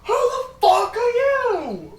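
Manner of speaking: high, slow bellow; furious shout. Acted. Father glares at the stranger and shouts, high and slow. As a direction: scream the question at them. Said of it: "he looks at you and goes".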